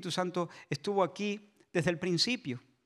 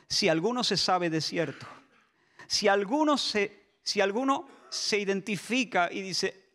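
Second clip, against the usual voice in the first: usual speaking voice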